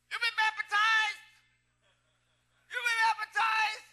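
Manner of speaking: loud, high, drawn-out imitation; mimicked slow holler to passers-by; Virgil's impaired speech. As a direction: call it out slowly, high and thick-tongued. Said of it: "He didn't talk right".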